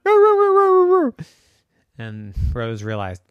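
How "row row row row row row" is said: loud high-pitched rolling barks; dog barking. Acted. Dog answering Rose's look, barking. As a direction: yap in one fast volley. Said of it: "and the dog went".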